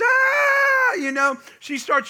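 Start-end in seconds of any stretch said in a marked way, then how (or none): 0.0-0.9 high, loud, sustained scream; imitated scream. Wife seeing her surprise gift, imitated scream. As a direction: mimic a thrilled scream at full pitch. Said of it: "she screams".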